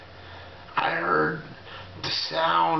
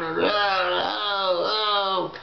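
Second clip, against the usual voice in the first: moaning; much slower than usual. Retold or imitated. Moans while being spun around.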